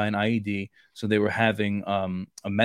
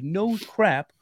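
exasperated retort; 8 semitones higher than usual. Retold or imitated. Soldier retorting during firefight.

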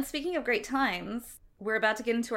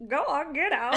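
lightly voiced whiny kid brother; whiny little sibling; 6 semitones higher, 3 dB louder than usual. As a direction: shoo them out in a kid-brother whine. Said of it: "our brother sounds exactly like this".

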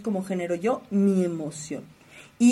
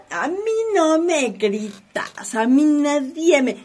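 higher and louder, a mock indignant outburst; indignant retort; 6 semitones higher, 5 dB louder, slower than usual. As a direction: act out someone who won't be yelled at, slow at first. Acting someone refusing to be shouted at.

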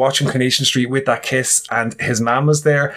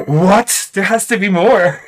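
higher, louder mock outrage; demanding disbelief; 6 semitones higher, 4 dB louder, slower than usual. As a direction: imitate indignant demand, pitch jumping up. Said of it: "I became so demanding".

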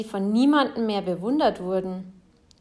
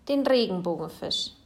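ordinary delivery

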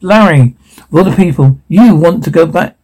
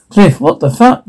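high shocked curse; 6 semitones higher than usual. Acted. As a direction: recoil in shock. Shocked at operators.

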